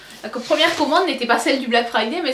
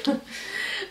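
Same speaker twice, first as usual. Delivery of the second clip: soft laugh; 2 semitones higher, 9 dB quieter than usual. Real, not acted. Happy the order is complete.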